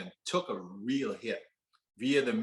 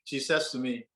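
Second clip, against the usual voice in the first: usual speaking voice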